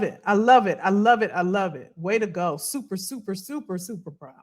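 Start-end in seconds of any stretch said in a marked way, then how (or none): 2.6-4.3 much softer, tender and sincere; tender pride. Real, not acted. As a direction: hush it like a heartfelt compliment. Praising a viewer warmly.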